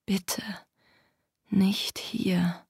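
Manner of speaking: very soft, anxious inner whisper; whispered plea. Acted. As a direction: plead under your breath. Stummes Flehen der Figur. (flehend geflüstert)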